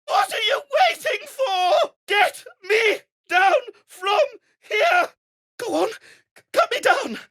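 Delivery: high, loud frantic shouts for help; panicked yelling. Acted. Gnome strapped to a spinning windmill, shrieking in panic. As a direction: scream to be let down, close to crying.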